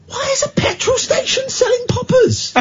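a hammed-up bewildered squeal; baffled inner voice. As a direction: squeak out the question in disbelief. Retold baffled thought at shop.

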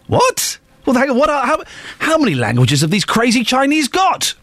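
high-pitched, baffled outburst; mock disbelief. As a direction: squeal "what" in comic bewilderment. Mock disbelief at the language list.

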